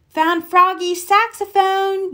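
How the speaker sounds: high, eager call; excited yell. Acted. Max shouts out what he found in the search, high and eager. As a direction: shout out the find. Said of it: "yelled Max".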